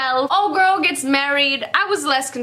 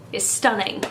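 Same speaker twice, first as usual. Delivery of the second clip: usual speaking voice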